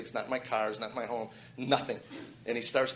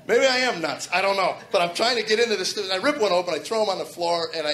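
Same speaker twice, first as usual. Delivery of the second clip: higher, louder, still laughing; excited; 5 semitones higher, 6 dB louder than usual. Real, not acted. Man telling crowd about ripping tapes: excited. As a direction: keep the giggle in it.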